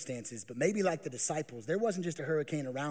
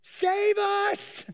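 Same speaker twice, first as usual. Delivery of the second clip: much higher and louder panicked pleading; frantic begging; 9 dB louder than usual. Acted. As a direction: beg Jesus in a rush of fear. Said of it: "Notice it doesn't say".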